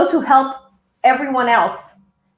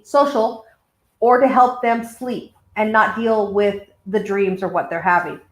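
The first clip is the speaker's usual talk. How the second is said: ordinary talk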